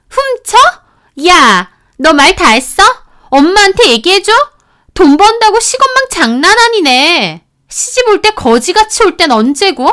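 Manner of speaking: sister-in-law screaming insults at the wife; screaming outrage. Retold or imitated. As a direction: scream back, offended and nasty.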